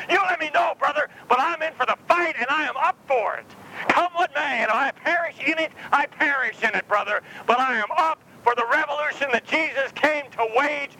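high, fired-up vow of commitment; eager committed believer. Pretend. Preacher voicing a believer eager to join the fight. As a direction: swear yourself in like a fighter. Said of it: "What I want you to do is say".

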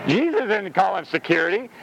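ordinary delivery

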